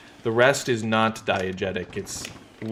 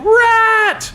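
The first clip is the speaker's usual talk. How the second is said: high shrill yell of "Rat!"; 7 dB louder than usual. Pretend. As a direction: shriek it out in alarm. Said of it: "I'd be yelling".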